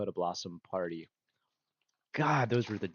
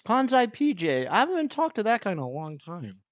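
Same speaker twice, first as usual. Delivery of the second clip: excited; 10 semitones higher, 6 dB louder than usual. Real, not acted. Sudden excited recognition.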